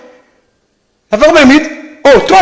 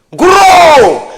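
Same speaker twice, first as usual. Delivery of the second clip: big stretched shout on gros; loud emphatic shout; 7 semitones higher, 4 dB louder than usual. Acted. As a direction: belt out the word gros. Emphasizing size. (cri appuyé sur gros)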